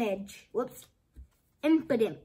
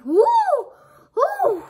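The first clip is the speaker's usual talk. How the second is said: whoop, much higher and louder; cheering woo; 9 dB louder than usual. Real, not acted. Cheering to viewers over a good pull, whooping. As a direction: let out a victory whoop.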